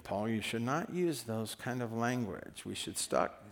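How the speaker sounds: lightly voiced scolding grandma, low and soft; a hint of a grandma's quiet reproof; grandma mode. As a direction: gently admonish like a grandmother. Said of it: "going into grandma mode".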